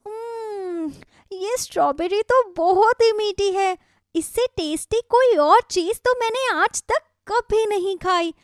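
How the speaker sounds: savouring a sweet berry; thrilled child voice; delighted little girl. Acted. Shikha tastes strawberry.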